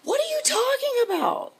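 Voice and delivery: very high-pitched disbelief; incredulous retort. Retold or imitated. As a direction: voice shoots up as if he's talking nonsense. Retold retort to husband's suggestion.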